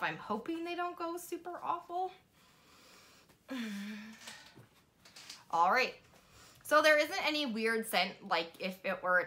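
sheet mask over mouth; speaking with wet mask on; talking through face mask. Real, not acted.

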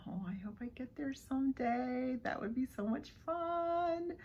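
soft, breathy-free hushed aside about the trip; hushed wish. Real, not acted. Unboxing vlogger wishing aloud, hushed. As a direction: let the wish slip out under the breath.

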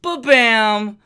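loud, high ba-bam; excited intro shout. Real, not acted. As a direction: burst in with it. Vlog intro exclamation.

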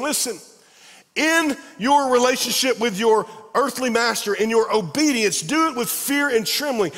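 high, loud impassioned preaching; full-force preaching. Acted. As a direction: drive the point home to the congregation.

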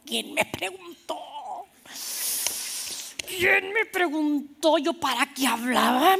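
mock wail, stretching the last word; exasperated self-reproach. Pastor scolding herself at home for talking, exaggerated wail.

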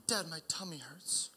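put-on whispered small-child complaint; whispered little girl. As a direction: whisper it like a toddler with a tummy ache. Daughter repeats complaint.

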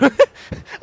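high, loud burst of laughter; squealing cackle of disbelief. Real, not acted. Reacts to guessed scare.

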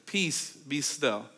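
slow, soft and still; quiet the storm gently; soft, slow words of calm; calm command. Retold or imitated. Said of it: "he just says this".

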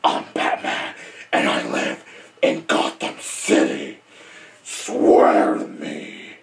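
very low, hoarse whispered growl; Batman, deep voice. Acted. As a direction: rasp it out deep and forceful, dragging the final demand. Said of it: "using the deep voice".

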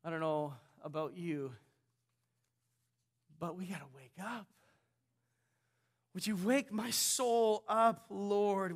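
a hushed plea to wake up; quiet prayer. Real, not acted. Preacher asking the Lord to wake his soul, softly.

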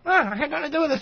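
high, silly puppet voice; squeaky little puppet character; Yogurt Liddy voice. Acted.

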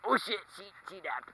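ordinary talk

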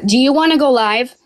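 loud, sudden call across the room. Real, not acted. To her co-host, asking to go live, called out loud.

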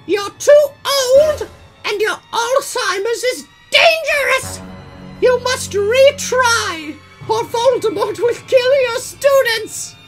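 very high, loud character yell; high-pitched theatrical shouting. A narrator's reading. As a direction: yell a slow, hammed-up warning. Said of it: "yelled Rumbridge".

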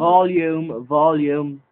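loud, drawn-out call, said twice; loud heckle. Real, not acted. Player heckling friend mid-sentence about volume, loud drawn-out call. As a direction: call it out over the other player. Calling out over the other player.

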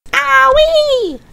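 very high squawking shriek; shrieking hen. Acted. The hen swallows the medicine and shrieks. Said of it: "shrieked the hen".

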